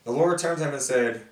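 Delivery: ordinary talk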